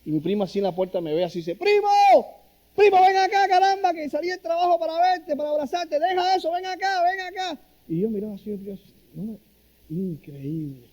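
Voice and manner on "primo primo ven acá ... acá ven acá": much higher, louder shout of "Primo"; yelling cousin. Retold or imitated. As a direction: call out across the street like an excited cousin. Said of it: "empieza a gritarme".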